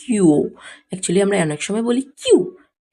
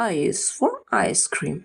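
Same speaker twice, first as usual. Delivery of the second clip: slow drill; 4 dB quieter, much slower than usual. Shown as a demonstration. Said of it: "slow teaching drill".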